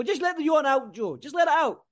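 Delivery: high, loud playful coaxing; teasing. Real, not acted. Host teasing co-host Joe about his yawn, high and playful. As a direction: egg him on to let the yawn out.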